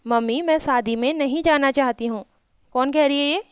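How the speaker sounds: ordinary talk, nothing marked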